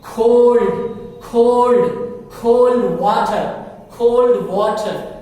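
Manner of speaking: cold said loud, with a puff on the K; loud drill. Shown as a demonstration. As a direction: call out "cold water" loudly. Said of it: "loud drill of cold".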